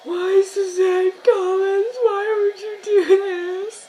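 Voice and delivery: high, loud, slow, sobbing plea to the author; mock anguished wail. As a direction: wail at Suzanne Collins like a betrayed fan. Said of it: "I was like".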